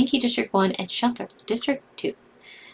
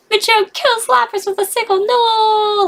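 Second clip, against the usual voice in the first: much higher, pained reading; dismayed wail; 12 semitones higher than usual. Real, not acted. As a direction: read it in rising despair. Favorite Lapras killed.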